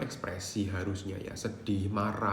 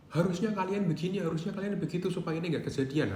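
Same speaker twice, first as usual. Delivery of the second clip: higher-pitched, fast accusing rant, acted out; mock angry blaming; 8 semitones higher, faster than usual. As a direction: blame everyone around you, hammed up a little. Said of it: "Nah, itu marah-marah".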